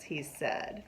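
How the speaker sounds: usual speaking voice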